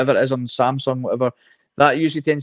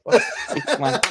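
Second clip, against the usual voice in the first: laughing exasperation; slower than usual. Retold or imitated. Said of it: "I was like".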